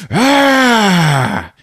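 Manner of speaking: long, high yell that slides down; drawn-out acted roar; mock scream. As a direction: let out a wild hammed-up holler. Retold character's scream.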